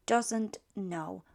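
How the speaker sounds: ordinary delivery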